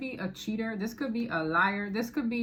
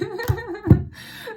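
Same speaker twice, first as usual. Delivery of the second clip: loud, high rising laugh; delighted burst of laughter; 11 semitones higher, 9 dB louder than usual. Real, not acted. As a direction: crack up at the card. Laughs at drinking knight card.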